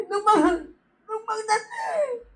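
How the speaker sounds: high, slow, crying with joy; joyful weeping exclamation; tearful joy. Retold or imitated. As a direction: overcome with relief.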